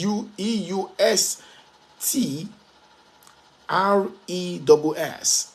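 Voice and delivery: slow letter-by-letter spelling. Shown as a demonstration. Speaker teaches listeners the word eustress, spelling it out slowly. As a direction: spell it slowly, pausing between letter groups.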